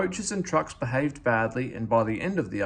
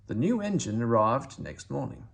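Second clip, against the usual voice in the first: ordinary delivery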